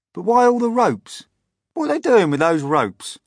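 high, loud questioning; baffled exclamation. A narrator's reading. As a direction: demand to know, loud and puzzled. Centipede puzzles over the ropes.

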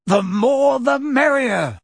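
high, slow and heartfelt; mean every word of the welcome; sincere. A narrator's reading. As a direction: Sirius warmly welcoming the family for Christmas. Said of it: "with such obvious sincerity".

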